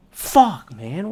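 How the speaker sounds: loud, high burst of frustration; short loud angry fuck; frustrated curse. Real, not acted. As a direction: spit the curse out. Venting about effort.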